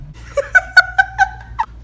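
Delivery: squealing high giggle; high tipsy laugh. Real, not acted. Laughs at her own joke.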